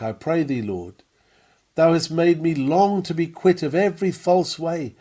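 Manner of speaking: normal talking voice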